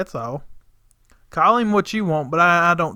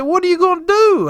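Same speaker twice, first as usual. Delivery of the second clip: exasperated; 11 semitones higher, 4 dB louder than usual. Retold or imitated. Exasperated question to quitting helper.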